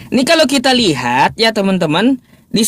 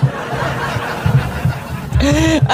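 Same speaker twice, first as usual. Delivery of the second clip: breathy laugh; 6 semitones higher, 4 dB quieter than usual. Real, not acted. Laughing at the idea.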